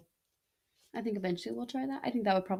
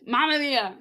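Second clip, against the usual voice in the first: put-on light Italian-accented "Mamma mia", said a bit louder; mock Italian exclamation; 4 semitones higher, 6 dB louder than usual. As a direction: toss it off like a cartoon Italian. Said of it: "all of the stereotypes about Italians are true".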